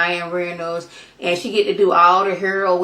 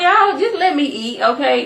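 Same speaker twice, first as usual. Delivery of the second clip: exasperated plea; 6 semitones higher than usual. Acted. Acted overwhelmed Michael fends off feeders.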